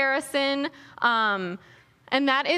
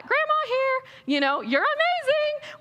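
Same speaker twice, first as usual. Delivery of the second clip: much higher, slow, sweet grandma voice; gushing grandma; 13 semitones higher than usual. Retold or imitated. Imitating a grandma praising everyone online, high and slow. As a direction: coo it like a proud grandmother. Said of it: "They do this".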